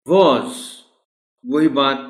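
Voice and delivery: ordinary delivery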